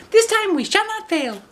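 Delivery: put-on very high, small child voice; youngest girl voice. Piazza volunteers to the wolf, tiny high voice. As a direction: chirp it like the littlest sister.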